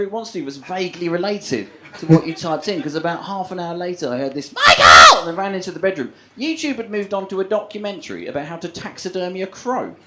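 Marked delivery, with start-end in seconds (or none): 4.5-5.2 very loud desperate yell; screamed name. Retold or imitated. Girlfriend calling from the bedroom, very loud yell. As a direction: scream for them to come now.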